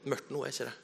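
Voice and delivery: gentle comfort. Retold or imitated.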